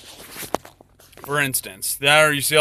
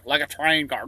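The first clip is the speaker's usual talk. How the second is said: ordinary talk, nothing marked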